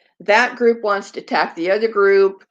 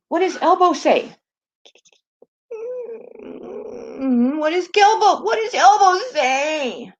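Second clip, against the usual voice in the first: higher, drawn-out sing-song question to the dog; high coaxing pet talk; 9 semitones higher, slower than usual. Real, not acted. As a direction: coax the dog to speak up.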